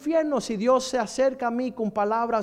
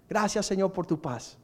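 soft prayer; 6 semitones lower, 7 dB quieter than usual. Real, not acted. Quiet prayer.

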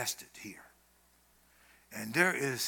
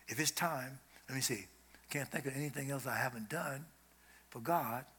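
calm acceptance; 3 semitones lower, 8 dB quieter than usual. Retold or imitated. Calm dying believer voiced.

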